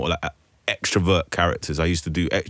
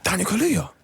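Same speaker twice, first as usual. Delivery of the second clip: high called-out name; imitating Idris calling out; 11 semitones higher than usual. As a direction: call his name across the street. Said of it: "He was like".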